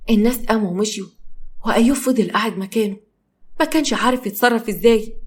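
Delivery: ordinary delivery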